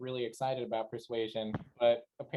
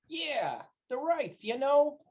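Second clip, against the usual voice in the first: higher-pitched eager agreement; conspiratorial nudge; 12 semitones higher, much slower than usual. Acted. Galan nudges Radix about the rights, eagerly knowing. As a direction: chime in as if elbowing a pal. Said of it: "gonna kinda elbow Radix and be like".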